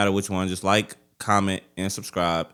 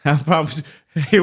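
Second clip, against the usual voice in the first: normal talking voice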